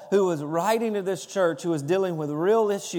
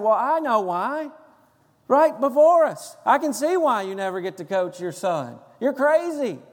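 fantasy comeback; 4 semitones higher than usual. Retold or imitated. Coach voicing the retort he wanted to give the yelling dad, heated and higher. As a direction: let loose the comeback he wished he'd said. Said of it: "I didn't say that".

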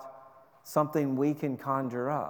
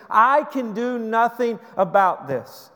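higher, louder desperate prayer; a mother begging God for a son, higher and louder; Hannah pleading; 7 semitones higher, 6 dB louder than usual. Acted. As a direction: cry out to God as a barren woman with nowhere else to turn.

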